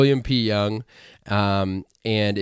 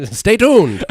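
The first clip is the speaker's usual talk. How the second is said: hint of a twenties announcer; lightly voiced old-timey radio tone; 1920s voice; 9 semitones higher, 9 dB louder than usual. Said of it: "that's your, uh, twenties voice".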